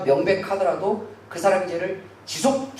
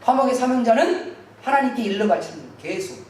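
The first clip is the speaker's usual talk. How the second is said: ordinary delivery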